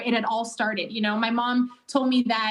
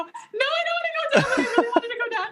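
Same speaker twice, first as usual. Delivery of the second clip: high, laughing mock protest; laughing self-quote; 13 semitones higher, faster than usual. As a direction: squeal it out with a laugh. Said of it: "And I go".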